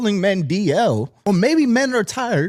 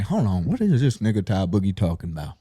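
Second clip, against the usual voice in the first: low, soft puzzled mutter; confused worker; 9 semitones lower, 4 dB quieter than usual. Retold or imitated. Amazon worker watching the clip, low baffled mutter. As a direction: mumble it, baffled. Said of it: "pushing the boxes ... like".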